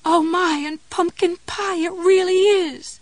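high, loud, elated cry; hoarse excited cry. A narrator's reading. Jessie celebrating the loose cap, crying out. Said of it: "cried in a hoarse, trembling voice".

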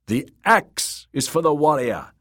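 projected, high reply; shouting back. Acted. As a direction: call the answer back from the crowd. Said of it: "Rus shouted back".

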